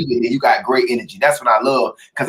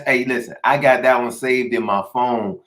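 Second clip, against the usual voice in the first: ordinary delivery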